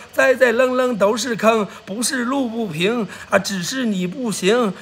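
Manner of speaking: rhythmic livestream well-wishing recital; sing-song streamer toast; rhymed blessing patter. Acted. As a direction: rattle off lucky rhymes with gusto.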